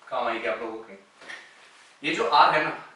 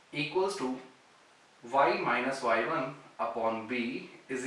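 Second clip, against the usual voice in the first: slow dictation; 4 dB quieter, much slower than usual. Shown as a demonstration. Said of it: "teacher dictating the formula".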